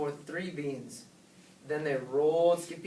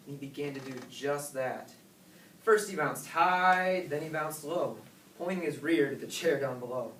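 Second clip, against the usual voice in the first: usual speaking voice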